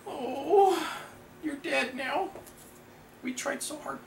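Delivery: hammed-up high-pitched sorrow; mock mourning. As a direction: coo a pretend apology to the dearly departed. Mock mourning of killed card character.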